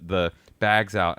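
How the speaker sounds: ordinary delivery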